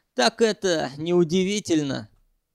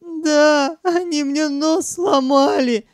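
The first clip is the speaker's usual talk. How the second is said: very high tearful wail; bitter crying; 10 semitones higher, 3 dB louder, slower than usual. A narrator's reading. Sanyok complaining about his broken nose, crying bitterly. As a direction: burst into tears while complaining. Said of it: "Санёк горько расплакался".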